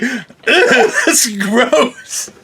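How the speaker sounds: giggling through disgust; laughing as you say it. Retold or imitated. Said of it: "I was like".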